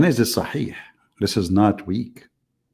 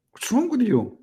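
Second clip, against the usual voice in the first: exasperated; 12 semitones higher than usual. Real, not acted. Exasperated at interrupting caller.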